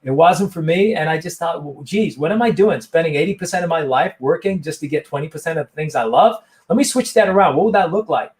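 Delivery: put-on lively retelling in a light American accent; guest's story retold in a light American accent. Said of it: "I'll just do my American accent".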